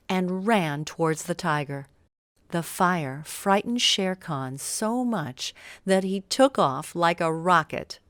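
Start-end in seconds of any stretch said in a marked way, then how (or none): none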